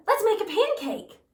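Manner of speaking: high and loud with eagerness; excited shout. A narrator's reading. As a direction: urge Mom on, thrilled. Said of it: "Jack shouted".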